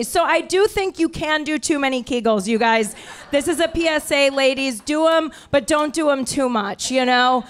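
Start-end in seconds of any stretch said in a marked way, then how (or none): none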